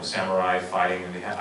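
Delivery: ordinary delivery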